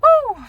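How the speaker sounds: loud, high cheering whoop. Real, not acted. Cheering loudly for the camera, a high whoop. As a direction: whoop with delight.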